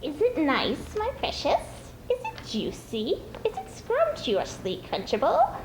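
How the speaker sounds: swooping high, slow, greedy creature voice; gleeful Gollum. Acted. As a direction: relish the thought of eating him. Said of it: "hiss with pleasure".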